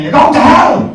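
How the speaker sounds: loud, high damning shout; hammed-up hellfire warning from a sat-nav. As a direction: bellow damnation at the driver.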